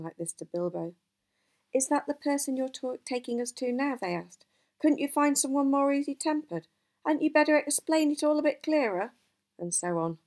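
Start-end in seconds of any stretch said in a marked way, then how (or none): 1.7-4.0 higher-pitched anxious query; mildly dismayed questioning. A narrator's reading. Anxious dwarves question the wizard. 4.8-9.1 higher-pitched querulous pleading; complaining questions. A narrator's reading. Dwarves complain to the wizard.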